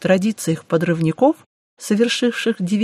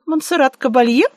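blurted out; 6 semitones higher, 3 dB louder than usual. Acted. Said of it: "брякнула я".

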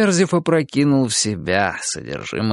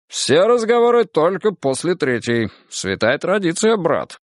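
higher-pitched, assured host's voice; confident host; 6 semitones higher than usual. A narrator's reading. Host insists on the third glass first, confident. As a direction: lay down the rule to a pal. Host lays down drinking rule.

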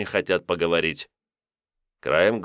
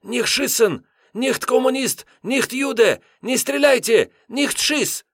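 very high, panicked pleading; frightened whisper-shout; 3 dB louder, slower than usual. A narrator's reading. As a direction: beg them not to shoot. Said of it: "перепуганным шёпотом заорал".

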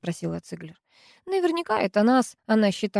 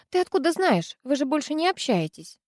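higher, startled asking; surprised question; 7 semitones higher than usual. Acted. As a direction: catch her off guard. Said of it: "спросила Диана". (удивлённый вопрос)

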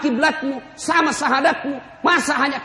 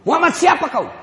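loud, higher, hostile challenge; angry demand; 6 semitones higher, 8 dB louder than usual. Acted. The sick man angrily demands which Muhammad has come. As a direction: snap at the visitor.

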